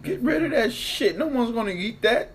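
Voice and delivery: high loud outraged rant; rant, scandalized and loud. Real, not acted. Outraged about the leftovers.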